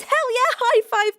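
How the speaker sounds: excited cheer. Real, not acted. Cheering a viewer's blackberry picking.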